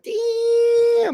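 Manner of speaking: a high, loud, amazed "Damn"; impressed exclamation. Retold or imitated. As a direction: blurt it out, blown away. Speaker reacts to the fight.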